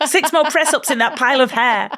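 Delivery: a boss drilling a trainee like a sergeant. Retold or imitated. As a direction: bark it like a boot-camp sergeant. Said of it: "you're like".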